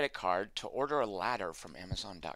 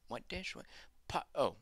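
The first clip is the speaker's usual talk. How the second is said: usual speaking voice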